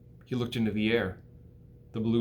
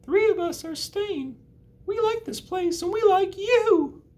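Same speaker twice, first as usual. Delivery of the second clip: very high, slow tiny voice; tiny spider greeting the pig; small spider voice; 4 dB louder, slower than usual. Acted. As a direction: squeak it like a baby spider. Spider daughters speak.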